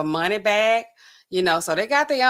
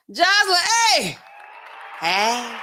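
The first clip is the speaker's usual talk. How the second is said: calling out a greeting; 8 semitones higher, slower than usual. Real, not acted. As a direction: shout hey across to her.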